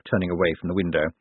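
plain reading voice

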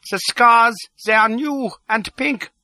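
very high character voice; high and emphatic on the discovery; excited little doctor. A narrator's reading. Doctor explains what gave him away, high and excited.

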